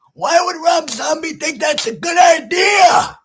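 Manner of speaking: high, loud, incredulous yell; exasperated shouting. Real, not acted. Fan demanding viewers explain the director, exasperated shouting. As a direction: yell it in disbelief, building to the end.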